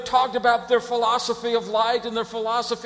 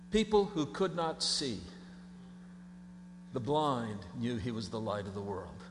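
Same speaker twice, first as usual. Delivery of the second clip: dropped low and soft; almost whispered reverence; hushed; 10 semitones lower, 11 dB quieter than usual. Real, not acted. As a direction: let it sink to a hush. Sermon drops to hush.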